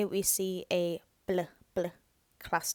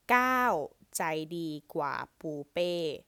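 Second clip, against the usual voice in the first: slow careful reading; slower than usual. Shown as a demonstration. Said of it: "reads the full sentence".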